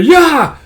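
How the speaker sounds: high, loud cry; sudden full-voiced shout; battle yell. Storyteller voicing a cry as the laser fires, loud yell.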